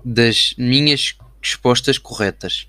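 drawn out, typed-along words; slow dictating. Shown as a demonstration. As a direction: dictate each word slowly. Dictating a message while typing.

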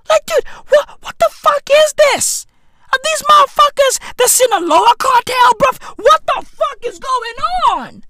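high, disbelieving yelp of shock; high-pitched mock outrage at the documentary; incredulous outburst. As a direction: squeal it like you can't believe your eyes. Incredulous rant about cartel.

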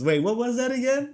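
drive-thru worker asking again, high and loud; confused worker. Retold or imitated. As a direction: ask again in disbelief. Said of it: "And she just goes".

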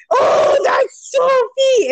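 high, loud, drawn-out mock shriek; losing it. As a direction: imitate a friend shrieking in recognition. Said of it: "both of us just lose it".

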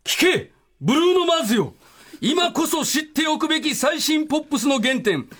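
high, ringing proclamation building to a shout; dramatic title call. Acted. Guest announces tonight's special on request, dramatic title call. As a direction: declaim it grandly, last title highest and loudest. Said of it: "タイトルコールお願いします".

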